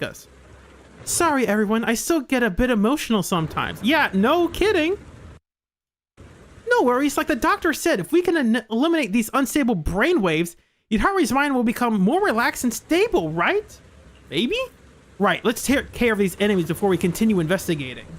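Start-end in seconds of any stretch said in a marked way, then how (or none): 3.8-14.6 lightly voiced upbeat character; cheerful higher character voice.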